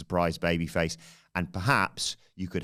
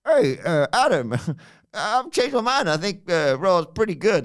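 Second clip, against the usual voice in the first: high mock voice of a friend; higher put-on impression of Sid, teasing; hammed-up higher voice mocking a co-host; imitating Sid; 12 semitones higher, 5 dB louder than usual. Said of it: "That's how he sounds".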